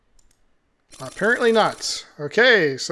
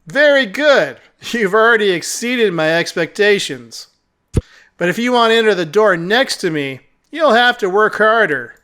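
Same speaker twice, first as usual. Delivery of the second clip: lightly voiced cat character, smugly testing; condescending talking cat; 4 semitones higher, 6 dB louder than usual. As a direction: lightly play a patronizing cat guide.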